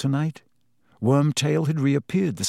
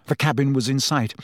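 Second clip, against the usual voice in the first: plain reading voice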